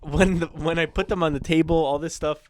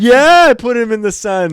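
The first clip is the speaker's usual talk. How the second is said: defensive retort; 9 semitones higher, 11 dB louder than usual. Retold or imitated.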